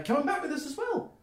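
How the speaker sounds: very high, loud gnome voice; Milan. Acted. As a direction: pipe up loudly as a little gnome. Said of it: "Milan goes".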